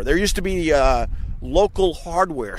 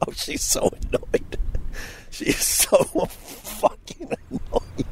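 laughing; 12 semitones higher, 5 dB quieter than usual. Real, not acted. Giggling after confrontation.

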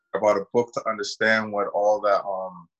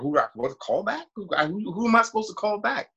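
confused disbelief; 12 semitones higher than usual. Retold or imitated. Confused reply in retold call.

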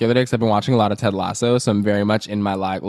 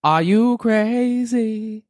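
put-on much higher, very slow London accent; 8 semitones higher, much slower than usual. Imitating [name]'s voice note in a slow high London accent.

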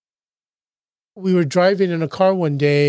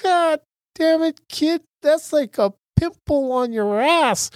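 much higher, slow, exasperated mock father; father's voice; 10 semitones higher than usual. Son mimicking his dad scolding him, high and drawn out. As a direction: ham up the dad telling his kid to toughen up. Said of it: "and he goes".

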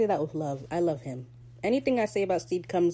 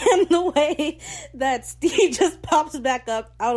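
laughing through words; 9 semitones higher, 7 dB louder, slower than usual. Real, not acted. Laughing while recounting scene.

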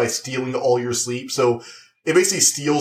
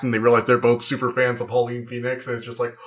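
ordinary delivery

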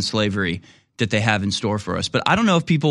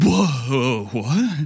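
shocked. Retold or imitated.

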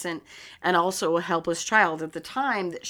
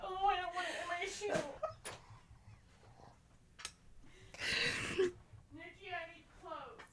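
laughing crying; 9 semitones higher, 18 dB quieter, faster than usual. Real, not acted. Laughing, crying, peeing herself.

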